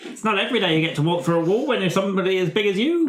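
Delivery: high, fast, laughing creature voice; high fast character voice. Acted.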